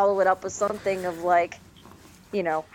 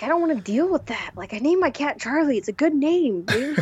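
exasperated; 7 semitones higher than usual. Retold or imitated. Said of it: "I'm just like".

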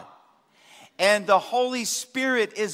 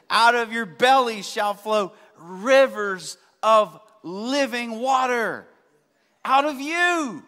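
slow, louder, ringing sermon proclamation; slow, louder pulpit cadence; preaching at full force; 3 semitones higher, 5 dB louder, slower than usual. Acted. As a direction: lean into the promise and preach it out.

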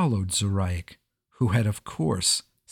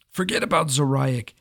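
commanding; 6 semitones higher, 3 dB louder than usual. A narrator's reading. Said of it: "commanded the king".

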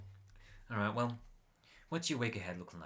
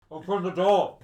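loud barked order through the door; police shout; 8 semitones higher, 16 dB louder than usual. Acted. Police outside yelling at the house to open up, loud shout. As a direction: bellow it like a cop outside.

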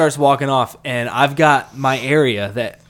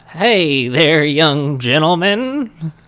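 put-on slow, louder old-timer greeting; old man voice; 4 semitones higher, 5 dB louder, much slower than usual. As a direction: drawl it like a frail old man. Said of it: "and he goes".

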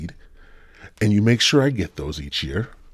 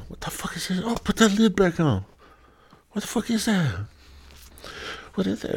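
high put-on voice, starting hushed and growing alarmed; freaked-out finder; 11 semitones higher, 4 dB quieter than usual. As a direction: react in a high voice as if the thing in the jar is scary. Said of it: "they open the jar".

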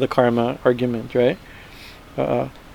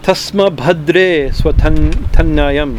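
slow verse recitation; much slower than usual. Shown as a demonstration.

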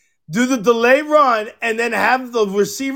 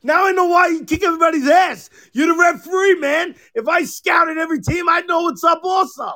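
higher, fast, yelled jab at the ref; retold taunting shout; 7 semitones higher than usual. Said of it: "I'm like".

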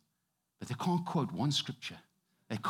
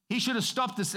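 ordinary delivery

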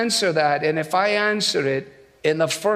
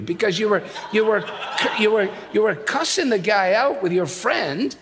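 usual speaking voice

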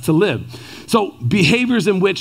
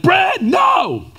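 high, startled shout of no; shouted warning; 14 semitones higher than usual. Retold or imitated. Wife shouting at Brad to stop, startled shout. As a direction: yell to stop him now. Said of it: "Heather obviously is like".

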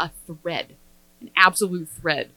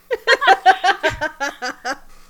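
big laugh; 9 semitones higher, 7 dB louder than usual. Real, not acted. Laughing at the silly dress.

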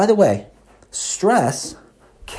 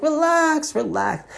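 mock calm friend. Imitating his roommate telling him to relax, high and lilting. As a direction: mimic the unbothered roommate soothing you. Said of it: "He's like".

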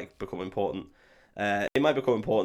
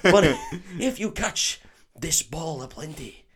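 higher mock-mystical Scottish accent, near laughing; portentous Scots, breaking into giggles; Oliver Wood; 5 semitones higher, slower than usual. Keeper teaching Harry the Snitch, giggly Scottish accent. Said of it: "for me to do a Scottish accent".

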